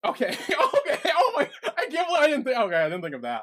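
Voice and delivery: high, loud, laughing fluster; laughing concession. Real, not acted. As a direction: give in through helpless laughter. Caught out by co-host's joke.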